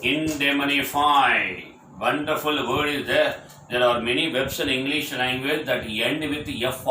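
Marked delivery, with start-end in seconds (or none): 0.0-1.6 slow headword. Shown as a demonstration. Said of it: "Next word".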